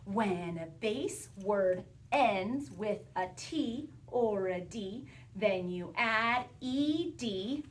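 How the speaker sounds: slow, measured word-by-word chant; slow recited rule. Shown as a demonstration. Teacher leading pupils through the spelling rule, slow recitation. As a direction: recite the rule slowly for others to join. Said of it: "do this with me".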